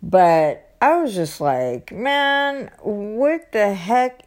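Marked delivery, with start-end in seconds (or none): 1.9-4.2 drawn-out, exasperated disbelief; slow, higher-pitched exasperation; retold annoyance. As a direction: stretch it out, baffled and annoyed. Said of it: "so annoyed".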